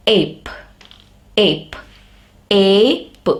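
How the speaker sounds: "ape" with A said as its letter name; long A sound. Shown as a demonstration. Teacher giving an example of long vowels, long A. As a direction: isolate each "ape", long ay, pausing between. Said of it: "In long sound, the letter is pronounced".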